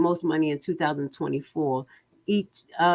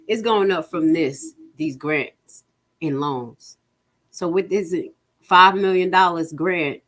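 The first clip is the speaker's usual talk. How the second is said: ordinary delivery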